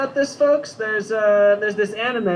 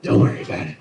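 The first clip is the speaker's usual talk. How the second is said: much lower gravel-growl date line; Clint Eastwood voice; 9 semitones lower than usual. Retold or imitated. Comic asking a woman out, low Eastwood growl. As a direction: growl it like a tough old cowboy. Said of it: "talking like Clint Eastwood".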